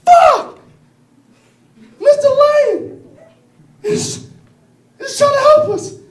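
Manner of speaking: very high, loud shrieking cry; hammed-up squealing deer outrage; panicked deer. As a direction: shriek at the lion in horror. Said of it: "The deer goes".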